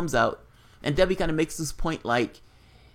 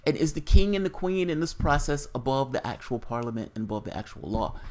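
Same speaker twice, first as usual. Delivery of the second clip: usual speaking voice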